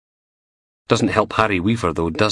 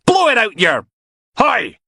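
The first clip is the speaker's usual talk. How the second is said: shouting; 5 dB louder than usual. Acted. Shouting match interrupted.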